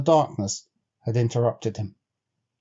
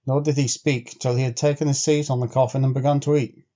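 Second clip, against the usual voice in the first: ordinary delivery, nothing marked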